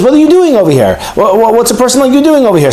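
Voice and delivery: high, challenging angel voice; high-pitched indignant challenge. Retold or imitated. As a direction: demand it like an offended angel. Angry angel confronts Moshe.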